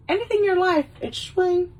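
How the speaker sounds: high, playful exclamation; high-voiced playful punchline; catchphrase delivery. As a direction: pitch it up like the movie line. Demonstrates the catchphrase again.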